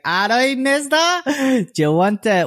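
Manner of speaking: loud, very high imitation of a woman soliciting; prostitute's come-on. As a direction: call out high, touting for custom. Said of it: "One of them came up".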